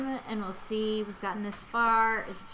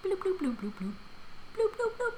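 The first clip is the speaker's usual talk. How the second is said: high bubbly machine bloops; silly time machine bloops; mock bloops; 10 semitones higher than usual. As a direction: bloop like a gadget. Time machine sound effects.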